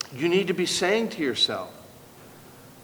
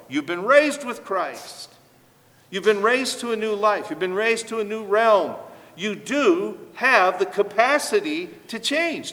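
emphatic proclamation; 6 semitones higher, 4 dB louder than usual. Real, not acted. Preacher proclaiming scripture truth.

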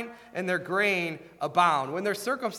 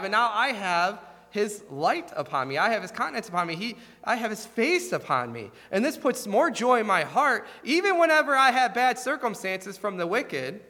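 fervent preaching; 6 semitones higher than usual. Acted. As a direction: drive the point home to the congregation. Preaching at rising force.

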